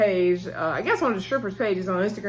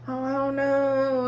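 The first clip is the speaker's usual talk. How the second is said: higher, slow, drawn-out mock reluctance; switched-up coy voice; 6 semitones higher, slower than usual. Mercedes putting off the coach on the phone, mock coy. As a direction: play hard to get, stretching it out slowly. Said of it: "she switched her voice up real quick".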